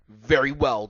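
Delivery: loud, firm reply; angry. A narrator's reading. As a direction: bite it out with mild anger. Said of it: "Dumbledore said angrily".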